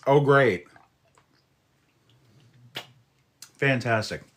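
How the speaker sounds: loud mock cheer, dripping sarcasm; sarcastic. Real, not acted. At an unwanted AI settings popup, loud sarcastic cheer. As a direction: fake delight at an annoyance.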